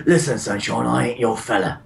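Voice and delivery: lightly voiced Cockney shopkeeper brushing him off; a hint of Cockney geezer, dismissive; Cockney accent. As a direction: shopkeeper tells the player off in a mild Cockney accent. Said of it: "But in a Cockney accent".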